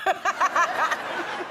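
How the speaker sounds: loud high laugh; high burst of laughter. Real, not acted. Laughing about Robin Williams.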